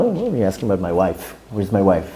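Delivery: low, hushed, put-out aside; flustered muttering. Acted. Sage asked about his wife by a visitor, flustered low muttering. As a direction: mutter it low, caught off guard.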